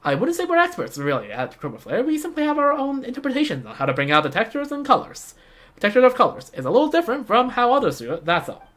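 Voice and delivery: high-pitched game designer voice; modest young designer. Acted.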